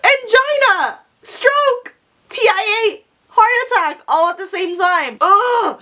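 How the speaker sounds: very high, hammed-up distressed cries; mock panic. Shopper faced with Boxing Day parking, theatrical high panic. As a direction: cry out as if collapsing, high and overdone.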